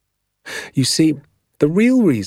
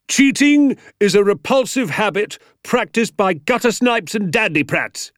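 high, strident teacher's rant; indignant high-pitched lecture; outraged tirade; 12 semitones higher, 3 dB louder than usual. Acted. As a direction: spit out the condemnation at the class. Teacher rants at cheating pupils.

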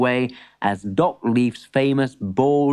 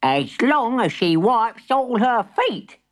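grudging granny; 9 semitones higher, slower than usual. Acted.

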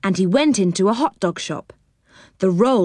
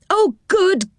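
much higher, gleeful welcome; delighted cry; 9 semitones higher, slower than usual. A narrator's reading. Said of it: "he cried".

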